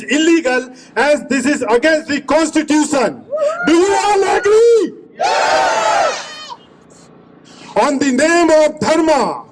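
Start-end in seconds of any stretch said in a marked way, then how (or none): 3.7-6.3 rallying call. Real, not acted. Asks crowd for agreement.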